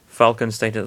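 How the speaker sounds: plain reading voice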